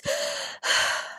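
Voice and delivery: long breathy sigh of relief; tired sigh. A narrator's reading. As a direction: let out a spent sigh. After rescue, exhausted.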